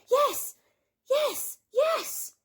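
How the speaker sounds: very high, squealing yeses; eager piglets. A narrator's reading. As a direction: squeal with glee. Piglets cheering.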